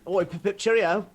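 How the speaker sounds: mock an old English gent, lightly; mock British accent. American friend mocking Brits: mock British accent. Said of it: "We do not say cheerio".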